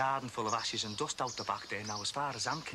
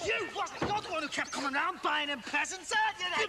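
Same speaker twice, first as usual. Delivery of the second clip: incredulous fury. Acted. Husband rounding on the friend who bought presents, high furious disbelief. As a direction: spit it back at him in disbelief.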